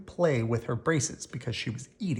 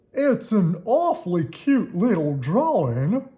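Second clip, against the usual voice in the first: put-on old man voice; 6 semitones higher, 6 dB louder, slower than usual. A narrator's reading. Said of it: "said Mister Hollander".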